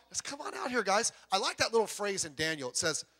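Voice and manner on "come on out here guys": high, casual coaxing; changed, friendly tone. Retold or imitated. King coaxing the men out of the furnace, mock-friendly. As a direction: imitate a king suddenly chummy. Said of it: "then he changes his tone".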